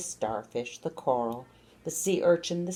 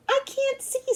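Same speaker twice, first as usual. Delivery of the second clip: much higher, tiny snail voice; squeaky snail. A narrator's reading. Snail in the dark forest, squeaking much higher. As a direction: squeak it like a lost little snail.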